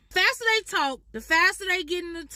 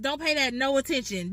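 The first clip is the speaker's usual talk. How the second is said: usual speaking voice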